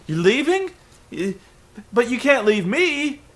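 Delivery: high, pleading mock whine; mock near tears. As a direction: ham up a wounded plea. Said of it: "near tears".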